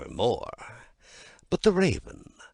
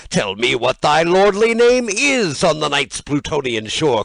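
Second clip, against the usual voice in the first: loud, ringing address to the bird; loud declaiming; 5 semitones higher, 11 dB louder than usual. Acted. Narrator hails the raven loudly, declaiming. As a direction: call out boldly to the raven.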